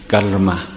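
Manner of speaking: "karma" with a distinctly sounded Sanskrit r; Sanskrit pronunciation. Shown as a demonstration. Teacher repeating the Sanskrit word for students, r sounded. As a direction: let the r in "karma" be heard before the m. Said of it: "making that special sound with the r".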